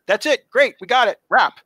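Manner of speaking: high, loud, brisk sign-off; called-out wrap. Retold or imitated. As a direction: announce to the whole crew that it's finished. Director calls the wrap.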